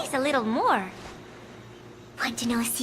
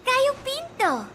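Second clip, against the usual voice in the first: delighted; 10 semitones higher than usual. Acted. Delighted at a photo.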